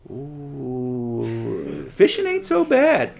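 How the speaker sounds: a worried follower muttering that fishing wasn't so bad; doubting disciple. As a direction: play a wistful fisherman second-guessing himself. Imagined disciple regretting following Jesus.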